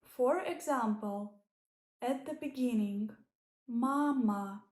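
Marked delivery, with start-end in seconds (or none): 3.7-4.6 mama with open Russian vowels, MA-ma; careful Russian demo of mama; Russian word mama. Shown as a demonstration. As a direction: say mama the Russian way, stress on the first syllable. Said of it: "means mom".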